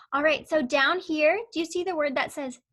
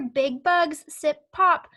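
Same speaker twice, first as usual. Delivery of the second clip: "big bugs sip pop" slow and louder, every word pointed out; emphasised, slow; 6 dB louder, slower than usual. Shown as a demonstration. Teacher showing pupils the words inside quotation marks, slow and louder. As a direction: point out each word slowly and firmly. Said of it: "notice right here".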